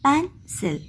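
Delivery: Pencil split in two, "pen", pause, "sil"; split into syllables. Shown as a demonstration. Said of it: "word को हमने टू parts में कर दिया". (Pencil को दो हिस्सों में तोड़कर: पेन - सिल)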